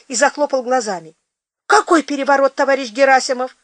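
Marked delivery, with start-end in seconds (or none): 1.7-3.6 high, alarmed asking; frightened question. Acted. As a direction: panic creeping in. Frightened peasant asks.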